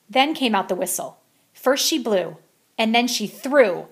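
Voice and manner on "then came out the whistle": ordinary delivery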